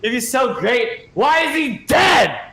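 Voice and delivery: a mock cruel jab, slowing at the end; mock taunt. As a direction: rub it in, hammed-up. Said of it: "And he's like".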